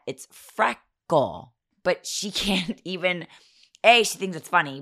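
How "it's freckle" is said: "freckle" stretched out slowly with the R clear; slow correction. Retold or imitated. Mother correcting her daughter, "freckle" drawn out syllable by syllable. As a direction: sound out "freckle" very slowly for a child. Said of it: "Viv, it's not that".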